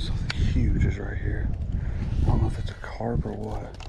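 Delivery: hushed whisper. Real, not acted. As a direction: keep it quiet so the fish stays. Stalking a big fish.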